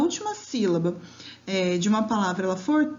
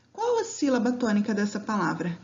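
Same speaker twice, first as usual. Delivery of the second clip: ordinary delivery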